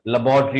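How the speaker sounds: लेबोरेटरी said as "luh-BAW-tree"; second pronunciation. Shown as a demonstration. Teacher repeating the second form, carefully modelled. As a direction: hit BAW in लेबोरेटरी, three short syllables. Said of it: "जो दूसरा pronunciation है".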